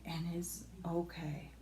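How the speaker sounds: breathy unvoiced whisper of reassurance. Real, not acted. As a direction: whisper it softly to yourself.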